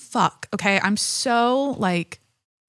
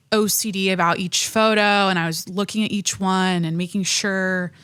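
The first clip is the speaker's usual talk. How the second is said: normal talking voice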